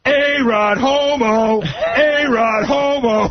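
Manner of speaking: high, long-drawn mock chant; imitated drunken heckle. Tipsy friend heckles at the ballpark, imitated high slow yell. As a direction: imitate a loud fan yelling slowly at a player. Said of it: "he starts yelling out really loud".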